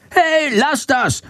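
high, squealing little-creature nagging, drawn out; Shandor scolding. A narrator's reading. Bat attacked by swallows, high shrewish scolding. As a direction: squawk a protest way up high. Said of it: "keift Shandor".

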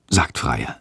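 usual speaking voice

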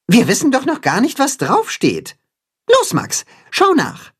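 high, eager character voice; Timme, urging. A narrator's reading. Timme sends mole Max to look, urging. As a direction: egg a friend on in a high voice. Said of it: "fordert Timme seinen Freund auf".

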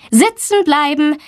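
lightly voiced stern teacher; firm command. A narrator's reading. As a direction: stop the class in its tracks. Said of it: "bestimmt Frau Blumberg".

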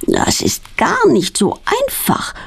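high, slow groan of effort. A narrator's reading. Girl struggling with the corkscrew on a wine bottle, groaning with effort. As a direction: strain through it, drawing out GAR. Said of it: "stöhnt sie".

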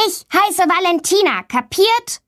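high, sharp furious retort; angry girl. A narrator's reading. Girl confronting bully after shoving him, high furious. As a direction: snap it back at him.

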